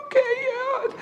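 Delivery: very high, soft tearful yes. Retold or imitated. Preacher voicing wife accepting his love, tearful. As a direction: imitate a choked-up, squeaky acceptance.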